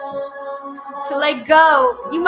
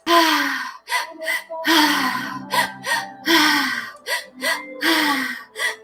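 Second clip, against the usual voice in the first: long sighing exhale; 4 dB quieter than usual. Shown as a demonstration. Demonstrating the release breath.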